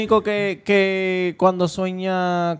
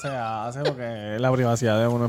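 softer, laughing mock sermon on privacy; laughing scold; 4 semitones lower, 4 dB quieter, slower than usual. Real, not acted. (regaño entre risas)

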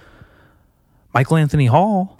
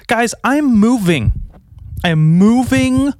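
big announcement; 9 semitones higher, slower than usual. Real, not acted. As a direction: proclaim it to the listeners. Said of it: "announcing big news".

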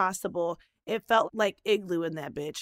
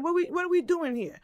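put-on New York accent; 7 semitones higher than usual. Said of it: "you know, New York accent".

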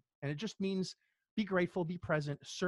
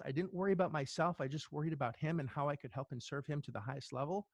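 usual speaking voice

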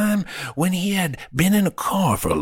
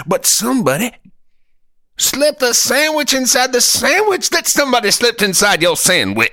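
pitched up, loud and frantic; high loud rant; 13 semitones higher, 6 dB louder than usual. Acted. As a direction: build it into a high squealing rant. Building comic rant.

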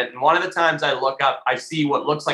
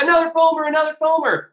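excited calling out; 12 semitones higher, faster than usual. Retold or imitated. Boat driver calls out fish.

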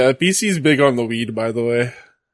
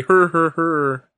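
mock referee count; 3 semitones higher, much slower than usual. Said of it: "Now I'm the referee".

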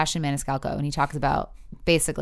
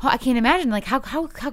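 higher, rising panicky what-ifs; mock anxious panic; 7 semitones higher, faster than usual. Mother imagining life without her kids, mock panicked. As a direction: spiral into worried disbelief. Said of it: "then I start to get anxiety".

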